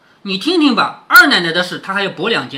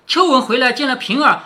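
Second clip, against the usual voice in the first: usual speaking voice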